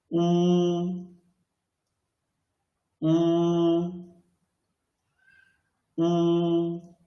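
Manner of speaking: "U" as oo, held high and level; first tone. Shown as a demonstration. Teacher drilling learners on tones, "U" in level first tone. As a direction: draw out "U" like a long even "oo", high. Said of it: "first tone".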